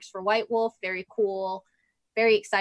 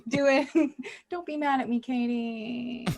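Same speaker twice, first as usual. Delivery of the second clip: whiny voice; slower than usual. Acted. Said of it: "I love a whiny T K voice".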